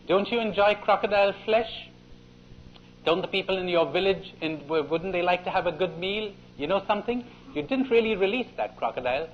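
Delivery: a sly little plotter; sly rabbit. Retold or imitated. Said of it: "Then said the rabbit to the boy".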